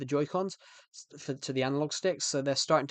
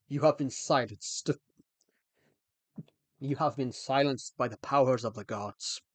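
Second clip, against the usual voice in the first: deep godly pronouncement. Pretend. Streamer playfully declares chat silenced by gods, deep voice. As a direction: proclaim it grandly in a deep voice. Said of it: "I can really go deep with my voice".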